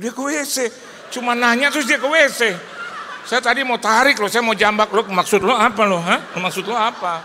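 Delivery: high, loud mock outrage; exasperated rant. Preacher retelling the rude host, loud mock outrage. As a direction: vent your annoyance, half laughing.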